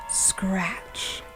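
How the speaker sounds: ordinary delivery, nothing marked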